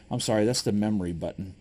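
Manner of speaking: ordinary talk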